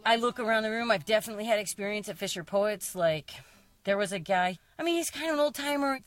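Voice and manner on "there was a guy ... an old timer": ordinary talk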